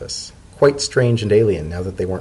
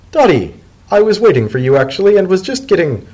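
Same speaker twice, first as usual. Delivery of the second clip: higher, louder character voice for Leafy; Leafy's voice; 10 semitones higher, 6 dB louder than usual. Acted. Leafy greets Dotted from the armchair, higher voice. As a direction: play the nervous friend, up high.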